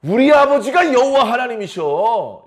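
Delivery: hammed-up proud bragging; boasting. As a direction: call them over, then brag loud, high and drawn out. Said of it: "이렇게 막 자랑한다는 것이죠".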